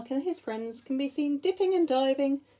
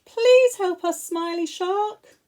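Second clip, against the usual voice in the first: pleading for help; 8 semitones higher, 7 dB louder, slower than usual. Acted. Fish trapped in net.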